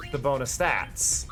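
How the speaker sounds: ordinary talk, nothing marked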